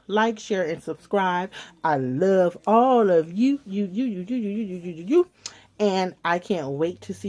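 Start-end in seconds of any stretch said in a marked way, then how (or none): 1.8-5.3 affectionate sign-off. Real, not acted. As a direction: spread the love to every viewer. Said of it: "sign-off to viewers".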